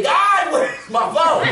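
slow, high, loud cry; frantic shout. Retold or imitated. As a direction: shout it to the sky. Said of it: "I wake up, I say".

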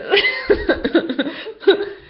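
loud high burst of laughter; high giggle. Real, not acted. Laughing at herself while ending the video, high giggle.

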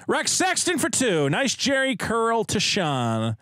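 big announcer read. Acted. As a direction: call it out like a host. Reading super chat announcer style.

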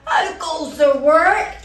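slow loud call; farmer calling out. Acted. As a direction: bellow it out slowly like a cross farmer. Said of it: "he called".

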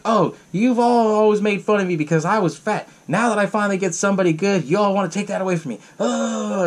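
hammed-up aggrieved whine; whiny attitude, retold. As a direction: play a wronged friend having a silly sulk. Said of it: "kinda had this attitude where she's like".

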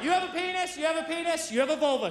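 put-on accusatory little girl. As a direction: announce it like a tiny accuser.

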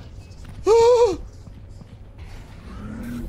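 long breathy frightened gasp; real gasp; scared gasp. Real, not acted. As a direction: suck in air in fright. Scared by monster.

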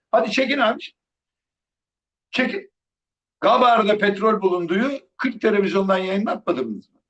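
high, mocking dare; sarcastic taunt. Real, not acted. Vlogger sarcastically daring supporters to keep cheering.

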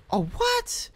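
high squeak of disbelief; shocked. Real, not acted. Gasp then shock.

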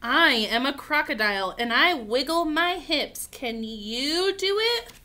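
slow, loud picture-book read-aloud; reading to children. Acted. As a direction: stretch each word out boldly for little ones.

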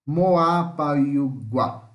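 Moapayugua drawn out very slowly, syllable by syllable; slow demo pronunciation. Shown as a demonstration. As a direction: sound out Moapayugua slowly for students. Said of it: "Vamos pronunciar agora?". (pronúncia lenta de Moapayugua)

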